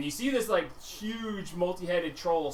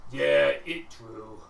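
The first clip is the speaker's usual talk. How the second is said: dim ogre; 5 dB louder, slower than usual. Acted. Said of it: "and he goes".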